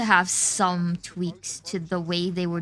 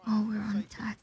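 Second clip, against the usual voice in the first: whisper; 7 dB quieter than usual. Real, not acted. Tense round, hushed call.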